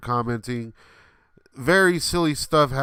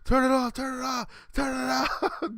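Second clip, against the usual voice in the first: imitated panicked yelling; 14 semitones higher than usual. Storyteller imitating electrocuted friend begging the sound guy, mock yelling. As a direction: plead frantically like someone being electrocuted, hammed-up. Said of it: "he starts yelling in the mic".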